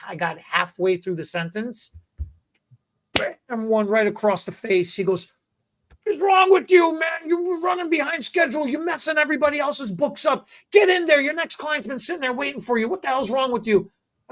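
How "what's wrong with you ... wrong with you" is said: hammed-up uncle scolding; uncle yelling. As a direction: bark it like a furious boss uncle. Uncle yelling after slap.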